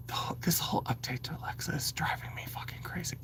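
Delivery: hushed, unvoiced and fast; secretive whisper to the audience. Real, not acted. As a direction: whisper so the speaker can't hear. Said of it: "I have to whisper".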